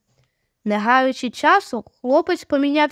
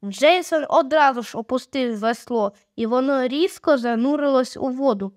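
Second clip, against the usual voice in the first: usual speaking voice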